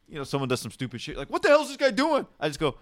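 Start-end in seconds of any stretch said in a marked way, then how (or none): 1.3-2.3 high-pitched exasperated outburst. Acted. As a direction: act out annoyance at another driver. Voicing annoyance at other driver.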